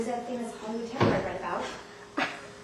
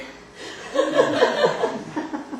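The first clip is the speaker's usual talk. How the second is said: giggle; 13 semitones higher, 8 dB louder than usual. Acted. Guest giggles.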